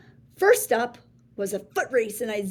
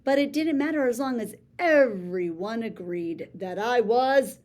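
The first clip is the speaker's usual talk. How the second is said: ordinary delivery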